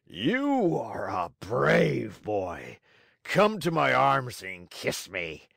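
expansive ogre, slow and drawn-out; big giant welcoming the boy; Fire Eater softened. A narrator's reading. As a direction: stretch the words grandly, heartily. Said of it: "opened wide his arms and said to Pinocchio".

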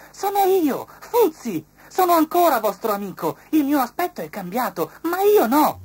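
very high, loud butterfly voice; tiny high fluttery voice; butterfly's voice. A narrator's reading. Fuzzi telling friends it is him, very high. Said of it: "disse la farfalla".

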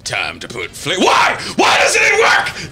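smooth ad read that snaps into a yell; announcer voice. Acted. Ad voice breaking into shout.

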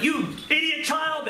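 high, drawn-out exasperated scolding; mock parental outburst; angry parent. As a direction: snap at the child in acted anger. Acts out angry parent before smack.

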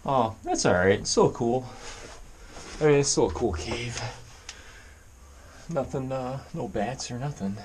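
ordinary talk

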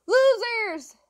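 high, loud taunting yell; really loud shout. A narrator's reading. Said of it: "Henry shouted really loudly".

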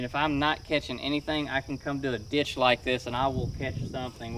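ordinary talk, nothing marked

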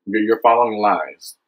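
ordinary delivery

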